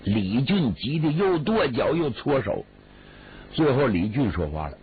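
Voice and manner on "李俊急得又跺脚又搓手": plain reading voice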